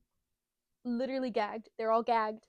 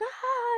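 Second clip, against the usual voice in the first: put-on high, loud kid's yell; mock battle cry; 13 semitones higher, 7 dB louder than usual. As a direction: yell out like a scrappy little fighter. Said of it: "And Luffy's like".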